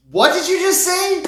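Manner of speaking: shocked squeal of disbelief; stunned disbelief. Retold or imitated. Stunned by the driver's reveal.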